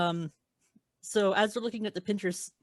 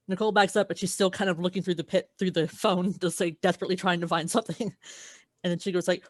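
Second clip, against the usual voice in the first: ordinary delivery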